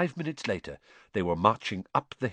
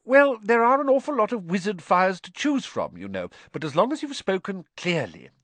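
higher anxious mother voice; higher fretting mum; Mrs Weasley, anxious; 11 semitones higher, 5 dB louder than usual. A narrator's reading. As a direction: explain with a worried fuss.

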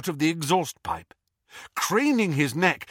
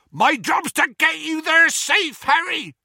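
loud, higher bellow over the wind; bellowing Hagrid; 12 semitones higher, 5 dB louder than usual. Acted. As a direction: bellow it like a big half-giant. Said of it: "bellowed Hagrid".

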